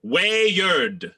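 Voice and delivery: "Wayard" pronounced WAY-urd, both beats clear; firm corrective "Wayard", way plus urd; two-syllable weird. Shown as a demonstration. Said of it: "Two syllables".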